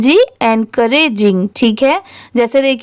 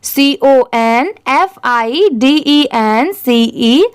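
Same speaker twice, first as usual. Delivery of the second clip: slow, letter by letter for the class; teacher spelling aloud slowly, letter by letter; slow spelling for repeating; slower than usual. Shown as a demonstration. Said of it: "आप भी मेरे बाद repeat करिए".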